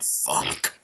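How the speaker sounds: low, soft, drawn-out mock despair; mock anguish. Host imitates co-host after the shot, low pained groan of a curse. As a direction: imitate a buddy suffering quietly through it. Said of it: "he was crying".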